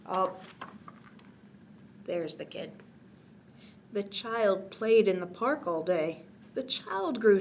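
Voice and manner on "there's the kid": plain reading voice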